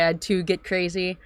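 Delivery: ordinary delivery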